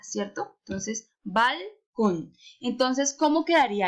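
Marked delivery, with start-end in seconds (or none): 1.2-2.3 balcón slowly, as BAL, then CÓN; split into syllables. Shown as a demonstration. Teacher dividing the word, balcón in two separate syllables. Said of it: "Acuérdense que separo la palabra".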